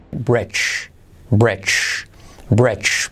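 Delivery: "bridge" with D and G as one J sound. Shown as a demonstration. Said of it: "porque es un sonido J". ("bridge" con sonido J final)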